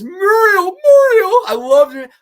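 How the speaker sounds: very high, loud whining wail; crying Courage voice. Retold or imitated. Reading a chat quote as Courage, very high and loud. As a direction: wail her name like a frightened little dog. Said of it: "Jack Evers says".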